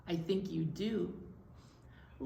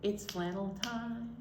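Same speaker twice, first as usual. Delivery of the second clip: plain reading voice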